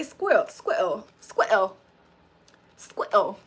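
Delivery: squirrel as "skwettle", mock UK; UK accent squirrel. Amused at how they say it, mock UK accent. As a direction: mimic UK squirrel with a short e, "skwet-ul". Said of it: "I can't believe they say squirrel".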